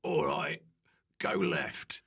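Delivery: isolated game commands with gaps; game character giving directions; video game voiceover. Shown as a demonstration. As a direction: bark out game instructions one by one. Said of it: "a voiceover on a video game".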